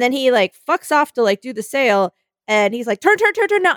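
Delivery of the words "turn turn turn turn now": high, urgent mock shout; imitated shouting. Sailor shouts steering orders to a novice, mock urgent. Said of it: "he's shouting all these instructions".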